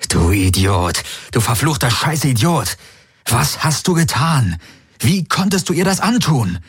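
furious despair at oneself; anguished self-reproach. A narrator's reading. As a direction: berate yourself, building to a high cry. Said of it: "Er hörte sich selbst aufschreien".

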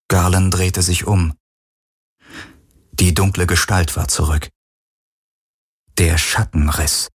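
ordinary delivery, nothing marked

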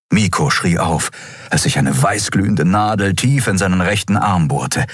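usual speaking voice

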